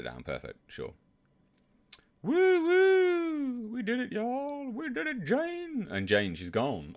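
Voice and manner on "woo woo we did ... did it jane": squealing victory whoop; whooping celebration. Pretend. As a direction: whoop like you just won.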